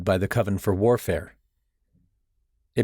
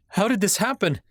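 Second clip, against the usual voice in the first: demanding; 12 semitones higher than usual. A narrator's reading. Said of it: "I demanded".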